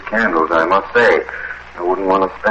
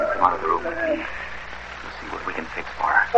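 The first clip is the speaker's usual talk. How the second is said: whispered, softer, so the sick woman won't hear; hushed; 9 dB quieter than usual. Acted. Man whispering to his companion beside a sick old woman, hushed. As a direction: keep it to a whisper by the bed. Quietly leads friend from sickroom.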